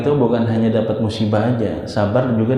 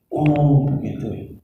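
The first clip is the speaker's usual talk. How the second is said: a modest "oh, I see" of discovery; as if hearing it first time; 3 semitones higher, much slower than usual. Shown as a demonstration. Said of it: "kayak baru pertama kali denger".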